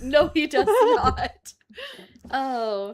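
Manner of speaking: loud, slow laughing agreement. Real, not acted. Agreeing the husband dislikes the singer, laughing loudly. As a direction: draw it out through big laughter.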